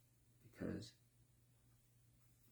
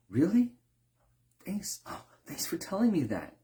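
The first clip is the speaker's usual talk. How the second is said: eager thanks; 9 semitones higher, 4 dB louder than usual. Retold or imitated. Chef thanking a complaining customer.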